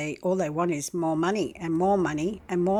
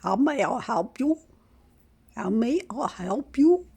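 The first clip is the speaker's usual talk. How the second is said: put-on singsong Indian accent, higher and rising; call-centre greeting in a mild Indian accent; mock Indian accent; 6 semitones higher, 5 dB louder than usual. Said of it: "In their accent".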